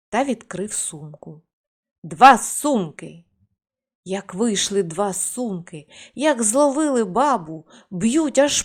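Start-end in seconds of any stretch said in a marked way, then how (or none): 2.0-3.2 slow, much louder command; shouted spell. Acted. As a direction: unleash the spell, slow and forceful. Grandpa sets the bags on wife. (повільний гучний наказ)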